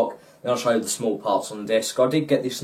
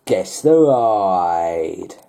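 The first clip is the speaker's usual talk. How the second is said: dramatic announcer; 6 semitones lower, much slower than usual. Acted. Segment title announcement.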